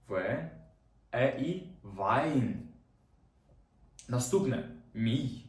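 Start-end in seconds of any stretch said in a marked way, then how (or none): 1.8-2.5 Wein said as vain, ei like ai; German ei as ai. Shown as a demonstration. Teacher demonstrates German word to class: Wein with ai. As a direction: model Wein with a clear ai. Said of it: "Читається як ай".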